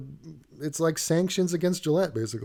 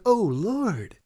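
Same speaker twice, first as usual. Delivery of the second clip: exasperated; 5 semitones higher than usual. Retold or imitated. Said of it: "and it's like".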